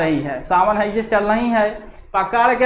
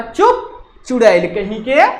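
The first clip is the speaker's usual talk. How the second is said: angry scolding. Acted. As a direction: snap at someone like a scolding mother. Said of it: "करा".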